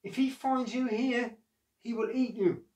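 high, anxious warning; enormous lady warning Jack about the giant; worried. Acted. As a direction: warn him in a worried voice. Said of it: "she said".

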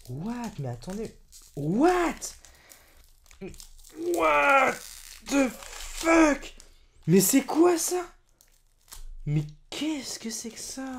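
stunned what the fuck; disbelief. Real, not acted. Youtuber baffled by the booster sticker, disbelieving.

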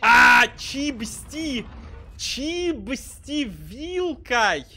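high, slow, stretched-out cry; mock drawn-out wail. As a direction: stretch every word out high and loud. Mocking teammate's "clean" call.

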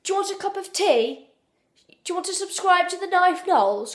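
high-pitched put-on posh English accent; high, playful posh English caricature; mock posh English. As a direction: have another go at an exaggerated posh English voice. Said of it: "Let's try this again".

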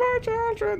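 very high mimicked cry; hammed-up falsetto lament; mocking wail. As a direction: imitate a wailing character, half laughing. Mimics game character's wail, laughing.

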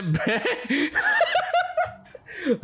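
long, very high-pitched giggle; laughing. Real, not acted. Gamer laughing at islanders' housing demands, long high laugh. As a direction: break into a squealing laugh mid-sentence.

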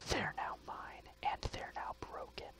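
sly whispered taunt; smug stage whisper; gloating whisper. Acted. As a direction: breathe it like a villain.

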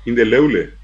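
"Indeleble" as in-de-leu-le; "Indeleble" with two vowels in place of bl; Guaraní-influenced pronunciation. Shown as a demonstration. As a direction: say "Indeleble" with u replacing the b. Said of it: "desaparece la B L y aparece".

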